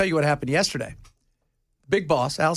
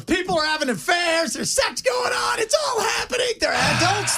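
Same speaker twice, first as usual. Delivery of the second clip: excited shout; 14 semitones higher than usual. Pretend. Host gleefully hypes the political rumors, rising excited shout. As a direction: shout the scandal out with glee.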